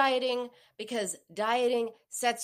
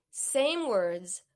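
ordinary talk

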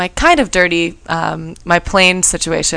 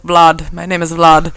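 put-on Russian accent; 2 semitones lower, 3 dB louder, slower than usual. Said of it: "he had a Russian accent".

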